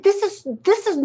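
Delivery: high, loud sputtering outrage; outraged. Retold or imitated. Speaker retelling her fury at bigots, outraged. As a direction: sputter at the petition table. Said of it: "I was outraged".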